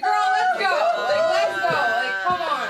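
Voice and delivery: high, loud mock yell; loud, hammed-up urging; exasperated outburst. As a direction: yell it in comic exasperation, dragging the words. Acted exasperated outburst at kid.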